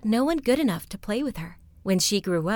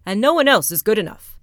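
loud, puffed-up proclamation; pompous rat king, louder; proud rat king; 7 dB louder than usual. Acted. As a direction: boast of the princess grandly. Rat King proposes to cloud.

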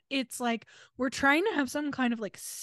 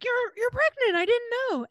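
much higher, startled imitation; mock surprise; 12 semitones higher than usual. Son imitating shock at mom's news, higher in mock surprise. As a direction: squeak it out as if caught off guard. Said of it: "Jared's like".

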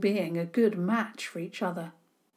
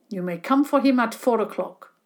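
precise; 3 semitones higher, 7 dB louder than usual. A narrator's reading.

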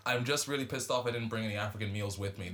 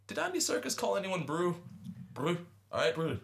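put-on South African accent; 5 semitones higher than usual. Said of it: "I can't do a good South African".

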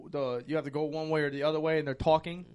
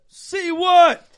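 high, loud indignant see what; retelling how he snapped at a teammate; exasperated shout; 13 semitones higher, 15 dB louder than usual. Retold or imitated. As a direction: yell it back in disbelief. Retold argument with teammate.

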